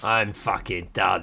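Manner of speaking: slow, soft and low; understated weary quitting; mock fed-up. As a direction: slowly give up on it all. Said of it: "They're just like".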